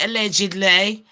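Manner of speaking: ordinary talk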